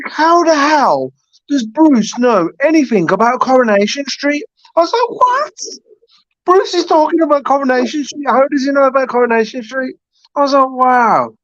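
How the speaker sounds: high-pitched incredulous retelling; astonished. Retold or imitated. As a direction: act out total disbelief, pitched right up.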